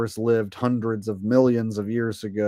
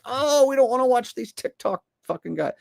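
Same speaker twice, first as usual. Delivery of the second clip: high-pitched mock whine; mock complaining viewer; 13 semitones higher than usual. Imitating people who don't like TikTok videos, high mock complaint. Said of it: "you know, like".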